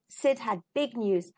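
ordinary delivery

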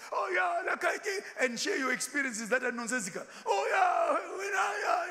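high, loud braggart cutting in; mocking show-off. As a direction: lampoon a know-it-all who hijacks the talk.

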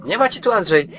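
high teasing sing-song at the monster; mock taunt. Real, not acted. (drwiący, wysoki głos do potwora)

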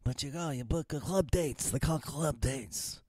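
a hint of a soft mob-boss rasp; lightly voiced Godfather advice; mock Godfather voice. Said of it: "he was talking like that".

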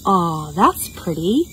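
ordinary talk, nothing marked

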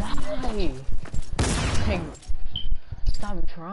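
loud, high yell at a player; angry shout. Real, not acted. Yelling at tryhard opponent.

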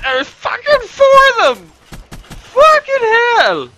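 very high, loud squeal of disbelief; high-pitched outcry. Real, not acted. Player outnumbered by four enemies, squealing in disbelief. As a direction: voice shoots way up in outrage. Outraged at being outnumbered in game.

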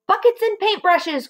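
high excited call; rally the others; excited cry. Acted. Mugglewump rallying the monkeys for his plan, excited cry. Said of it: "cried Mugglewump".

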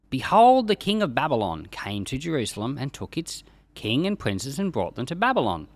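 ordinary talk, nothing marked

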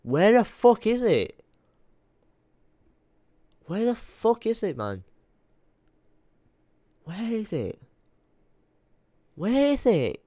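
fed-up whining search; frustrated. Real, not acted. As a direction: pitch climbs in real frustration. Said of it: "I'm getting pissed off".

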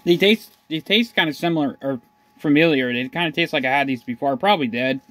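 chewing while talking; talking between bites; mouth partly full. Real, not acted. Seen 1: ate pizza stick before; wipes mouth, lips pursed between words.

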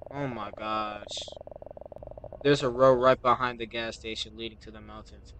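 ordinary talk

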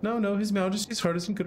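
a lightly voiced fairy sidekick with a lilt; cheeky sidekick voice. Sidekick grumbling to Ollie about King Tom, lightly voiced.

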